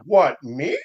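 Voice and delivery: feigned surprise at being accused; Daggett. Retold or imitated. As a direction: play innocent with a high questioning lift. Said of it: "And Daggett's like".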